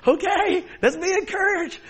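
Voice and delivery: very high, loud brightening up; forced cheer. Retold or imitated. Preacher urging a glum congregation, high, loud cheer. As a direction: push some cheer into it. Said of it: "and I go".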